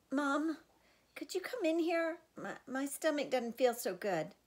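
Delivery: playing sick to fool mom; mock sickly call; fake sick voice. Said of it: "using my sickest voice".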